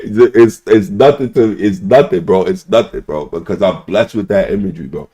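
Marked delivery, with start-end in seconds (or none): none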